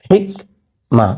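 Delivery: normal talking voice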